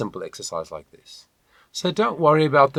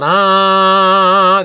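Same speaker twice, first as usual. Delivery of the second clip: nasal ah; 8 semitones higher, 12 dB louder than usual. Shown as a demonstration. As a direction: open "ah" sung high and loud through the nose. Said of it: "the air is flowing through my nose".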